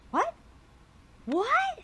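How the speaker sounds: sharp high shocked "what". Acted. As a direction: gasp it out in disbelief. Shocked by opponent's move.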